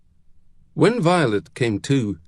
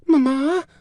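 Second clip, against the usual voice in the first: put-on high little girl's voice, rising at the end; questioning, high-pitched child's call; 4 dB louder than usual. A narrator's reading. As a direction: call for mother, high and pleading. Child calls mother a second time.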